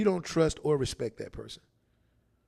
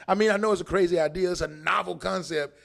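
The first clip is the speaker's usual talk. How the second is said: normal talking voice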